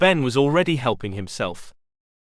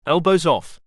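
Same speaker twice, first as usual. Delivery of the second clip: barked stern command; sharp shout; 4 semitones higher, 3 dB louder than usual. A narrator's reading. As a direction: snap it out loudly at the boy. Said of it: "the shouting about his elbows".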